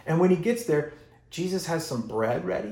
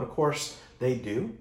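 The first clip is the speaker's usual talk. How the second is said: normal talking voice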